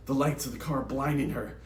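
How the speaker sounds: plain reading voice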